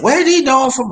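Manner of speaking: high, loud demanding question; retold suspicious question. Girlfriend questioning him about new friends, retold suspicion.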